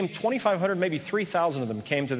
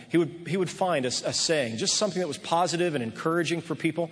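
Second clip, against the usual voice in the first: usual speaking voice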